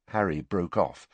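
ordinary delivery, nothing marked

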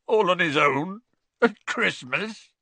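high, loud tearful wail; choked up. A narrator's reading. Gamekeeper pleads for his lonely hippogriff, choked up. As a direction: let it rise and drag into a wail. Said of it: "choked Hagrid".

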